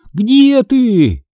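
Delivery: high, stretched searching cry; shouting. A narrator's reading. Girl searching for her missing brother, high shout. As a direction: call out as if across a yard. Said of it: "изо всех сил крикнула я".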